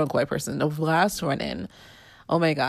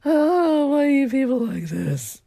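exasperated at the sneezing kid, a mock wail; exasperated outburst; 8 semitones higher than usual. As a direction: throw up your hands at the germy kid. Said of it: "I'm just like".

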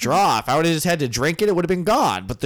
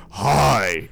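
Jim Carrey character; 5 semitones lower than usual. Retold or imitated. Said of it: "Jim Carrey when he's that one character".

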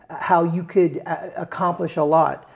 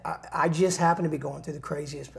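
ordinary talk, nothing marked

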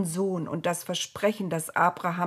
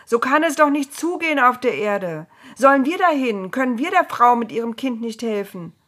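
loud, higher-pitched protest; raised voices pleading for action; calling out; 5 semitones higher, 8 dB louder than usual. A narrator's reading. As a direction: call out in indignant appeal. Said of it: "riefen sie".

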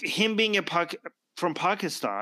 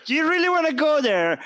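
much higher, louder challenging question; incredulous challenge; 11 semitones higher, 5 dB louder than usual. Real, not acted. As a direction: throw down the challenge, pitched high and loud. Challenge repeated, escalating.

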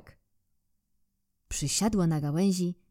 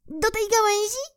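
squirrel. Acted. Squirrel voiced in story.

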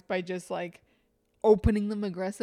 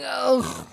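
fake death groan; 5 semitones higher, 9 dB louder than usual. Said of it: "And he would die".

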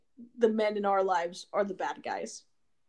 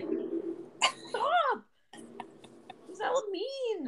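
high-pitched; 14 semitones higher, 7 dB quieter, much slower than usual. Retold or imitated. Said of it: "I was like".

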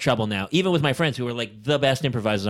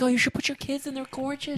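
gushing fan voice; 11 semitones higher than usual. Retold or imitated. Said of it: "And everyone's like".

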